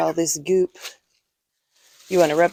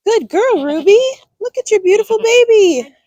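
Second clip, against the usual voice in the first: high, loud happy praise; high praising voice; 13 semitones higher, 7 dB louder than usual. Real, not acted. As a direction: praise the mother goat brightly. Praising mother goat after birth.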